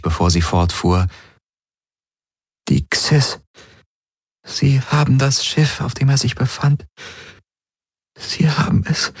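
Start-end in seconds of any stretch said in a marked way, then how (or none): 2.7-9.1 higher, slightly fragile woman's voice; halting, with pauses to gather herself; steadying herself. Acted. Ylana recounts what happened to the ship, steadying herself. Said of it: "sie atmete tief durch".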